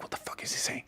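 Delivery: hushed, voiceless whisper; whispered aside. Acted. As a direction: whisper in disbelief to your spouse. Spouse's private aside during doctor's talk.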